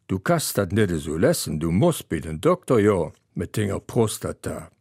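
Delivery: ordinary talk, nothing marked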